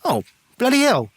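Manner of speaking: high, loud exasperated curse; guard recognizing a feared brawler. Acted. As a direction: groan it up to your mate. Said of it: "He looks and goes".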